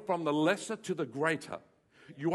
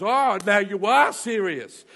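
slow, loud voice of God, pleased; God's voice; 3 semitones higher, 11 dB louder, slower than usual. Retold or imitated. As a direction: say it slowly and loudly, like God taking note. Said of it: "God goes".

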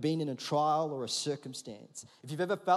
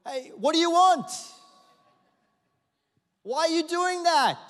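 loud, high annoyed shout; irritated yell back; 12 semitones higher, 8 dB louder than usual. Pretend. Father pretending to yell back at his daughter, irritated shout. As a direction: snap back at the kid loudly. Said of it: "I don't yell back going".

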